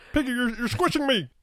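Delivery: high, strained frog puppet voice; squeezed little frog voice; Kermit the Frog. Acted. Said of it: "Kermit the Frog and Piggy in the bedroom".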